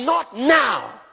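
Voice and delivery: loud, firm refusal to your own body; firm command. Pretend. Preacher commanding his body, firm loud no.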